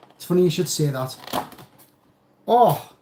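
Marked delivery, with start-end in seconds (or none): 2.5-2.9 sudden high, louder oh; surprised oh. Real, not acted. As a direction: react to an unexpected treat. Wife hands him a delivery.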